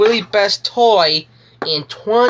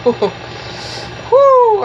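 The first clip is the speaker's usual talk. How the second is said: excited; 12 semitones higher than usual. Real, not acted. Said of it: "I need to calm down".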